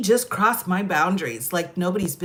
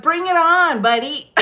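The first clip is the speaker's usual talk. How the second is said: loud, raised-pitch mock bravado; defiant challenge; 8 semitones higher, 6 dB louder than usual. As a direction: dare them to come at you. Retold challenge to an opponent.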